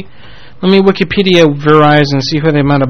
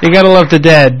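normal talking voice

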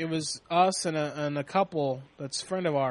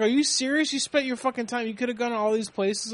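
incredulous; 7 semitones higher than usual. Retold or imitated. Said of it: "I was like".

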